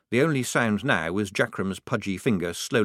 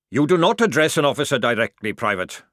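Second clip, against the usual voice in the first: loud sergeant's reprimand, pitched up; snapped; 5 semitones higher, 7 dB louder than usual. A narrator's reading. Sergeant rebuking a private for addressing an officer, snapping. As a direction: snap it at a soldier out of line. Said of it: "snapped Jackram".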